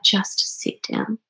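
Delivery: slow, unvoiced, soft insistence; slow whisper. Granny whispers to her crying granddaughter, slowly. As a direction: hush her gently and slowly.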